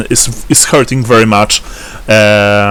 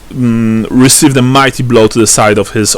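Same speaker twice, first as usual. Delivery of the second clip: normal talking voice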